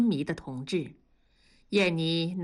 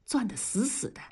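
usual speaking voice

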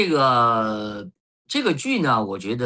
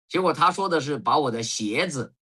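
usual speaking voice

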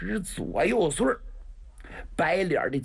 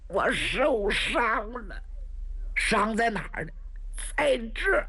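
pained wounded voice; 7 semitones higher than usual. Acted. Wounded man telling 白公子 where he is hurt, strained. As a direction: squeeze the words out through pain.

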